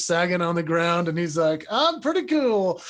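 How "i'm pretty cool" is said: put-on higher, smug little-kid boast; light mock toddler brag. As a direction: imitate a three-year-old showing off. Dad voicing his toddler son.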